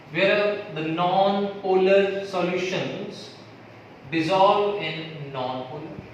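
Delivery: slow dictation. Acted. Dictating rule to students.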